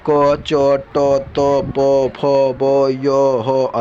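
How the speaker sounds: each letter, क, च, ट, spoken very slowly and apart; slow letter drill. Shown as a demonstration. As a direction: spell out क, च, ट slowly for the class. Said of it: "teacher reciting consonants".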